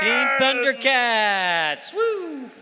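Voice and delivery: loud slow hype, rising woo; excited shout. Real, not acted. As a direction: whip up the crowd. Announcer hypes the team.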